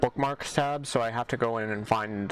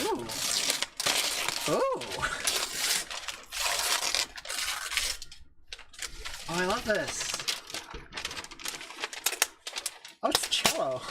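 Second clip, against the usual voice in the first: delighted; 9 semitones higher than usual. Real, not acted. Delighted at a present.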